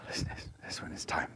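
breathy hushed aside; whisper. Real, not acted. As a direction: lean in and whisper it like a secret. Secret aside to congregation.

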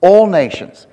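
all punched loud, falling after; stress on all. Shown as a demonstration. Preacher insists it is not one nation, all stressed loudly. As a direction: land hard on the word all. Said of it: "not one".

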